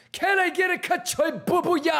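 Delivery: very high squeaky clown shout; silly clown. Acted. Streamer clown chant.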